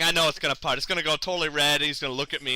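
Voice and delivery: normal talking voice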